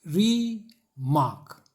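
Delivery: "Remark" slowly split as री, mark; "remark" in two long, separate beats; one puff per syllable. Shown as a demonstration. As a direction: give each half of "remark" its own puff. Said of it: "हर झोंका एक syllable".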